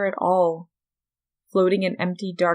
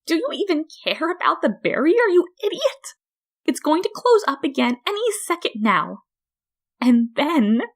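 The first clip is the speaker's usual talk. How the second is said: much higher, shrill furious voice; furious Flowey; 10 semitones higher, 3 dB louder than usual. A narrator's reading. Trapped flower berates the human, high and furious. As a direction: scream insults at him.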